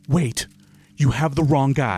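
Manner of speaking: loud, high panicked plea; pleading voice. Acted. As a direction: beg them, voice up high. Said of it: "I'm gonna go".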